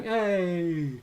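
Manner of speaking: high, drawn-out little "hey"; chirpy sidekick. Retold or imitated. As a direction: lightly mimic a smaller hype person. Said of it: "next to them going".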